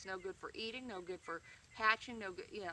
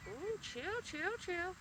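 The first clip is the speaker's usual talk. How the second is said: calming hissing hen; 10 semitones higher, much slower than usual. Retold or imitated. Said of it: "I'm like".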